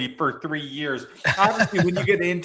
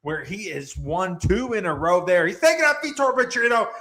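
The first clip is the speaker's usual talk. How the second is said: announcer hype intro; 4 semitones higher than usual. Acted. Host welcoming fight fans, booming announcer hype. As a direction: hype the crowd like a ring announcer.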